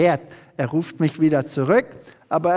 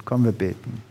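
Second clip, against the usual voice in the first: ordinary talk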